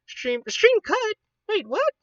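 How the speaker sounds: startled, high-pitched alarm; sudden shock, voice jumps up; surprised. Real, not acted. Stream suddenly cuts out.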